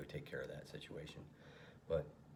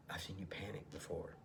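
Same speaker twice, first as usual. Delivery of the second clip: demon voice; 6 dB quieter than usual. Acted. Alter ego demon taunting Thomas in a whisper. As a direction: hiss it like a demon in his ear. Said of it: "his alter ego demon is talking about".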